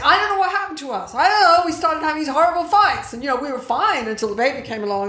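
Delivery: high, rushed, bewildered complaint; mock distressed. Couples baffled by their horrible fights, mock distressed. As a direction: pour it out in a high, fast fluster.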